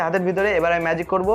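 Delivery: usual speaking voice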